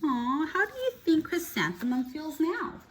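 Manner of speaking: ordinary delivery, nothing marked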